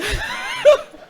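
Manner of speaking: a very high, loud burst of real laughter. Real, not acted. Preacher laughing with the congregation about feeling young again, very high laugh.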